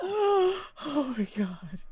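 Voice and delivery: long gasped oh my God, high and slow; gasp, then draw it out in shock; real stunned gasp. Real, not acted. Stunned reaction to the game.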